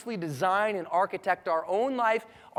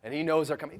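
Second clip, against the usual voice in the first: ordinary delivery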